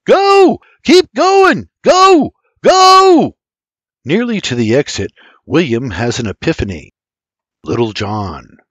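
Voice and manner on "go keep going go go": high, loud driving commands; urgent full-voice bursts, pushing them on; shouting. Acted. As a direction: yell them down the stairs.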